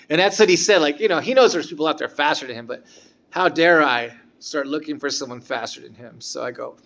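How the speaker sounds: normal talking voice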